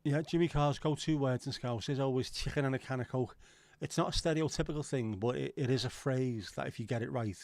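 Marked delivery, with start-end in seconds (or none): none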